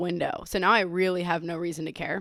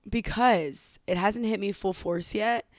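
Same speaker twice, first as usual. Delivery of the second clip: normal talking voice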